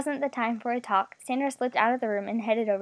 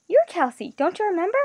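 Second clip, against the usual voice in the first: raised, startled disbelief; higher pitch, taken aback; surprised; 7 semitones higher, 4 dB louder than usual. A narrator's reading. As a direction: ask in rising astonishment. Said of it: "The voice registered surprise".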